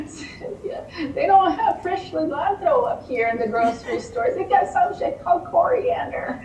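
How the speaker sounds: high, laughing mock-wail of a homesick friend; hammed-up tearful complaint, laughing through it; mock sobbing friend. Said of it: "she's sobbing, sobbing".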